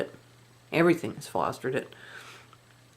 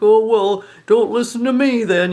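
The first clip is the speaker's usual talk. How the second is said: a put-on pouty voice, louder and higher; sulky mock hurt; 4 semitones higher, 6 dB louder than usual. Mother-in-law sulking when told she's resented. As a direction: whine it like a kid kicking the dirt. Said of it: "it'd be like".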